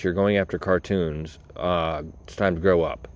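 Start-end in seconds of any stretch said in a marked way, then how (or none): none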